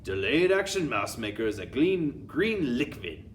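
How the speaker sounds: commanding witch with a put-on accent; Grand High Witch. Grand High Witch addressing her witches, commanding put-on accent. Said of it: "explained the Grand High Witch".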